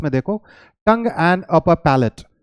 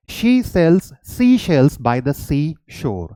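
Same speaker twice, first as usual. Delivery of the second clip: very slow, careful tongue twister; slow, deliberate pronunciation drill; slow demo; much slower than usual. Shown as a demonstration. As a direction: say each word slowly and clearly. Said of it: "अब आप इसे बोलो".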